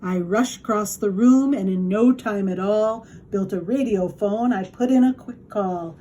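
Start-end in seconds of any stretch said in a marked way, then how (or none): none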